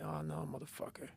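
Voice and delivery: muted retelling of a weary inner protest; dejected inner voice. Retold or imitated. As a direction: quietly groan it to yourself, imitating dread. Runner's inner dread at ten miles.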